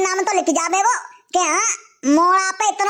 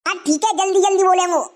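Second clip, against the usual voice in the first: normal talking voice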